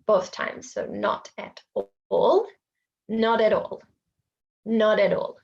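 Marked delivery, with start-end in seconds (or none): 3.1-5.3 "not at all" as no-ta-tall; linked consonant. Shown as a demonstration. Teacher demonstrating linking. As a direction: roll t into the vowel. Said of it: "T and the A".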